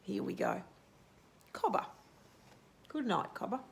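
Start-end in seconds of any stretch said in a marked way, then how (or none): none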